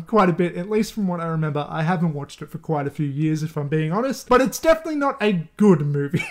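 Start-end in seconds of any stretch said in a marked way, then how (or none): none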